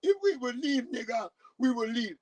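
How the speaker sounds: very high wailing scream; mock screaming. Mocking the woman begging Mister Trump, high screaming. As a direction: imitate a hysterical pleading screamer. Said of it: "She up here screaming".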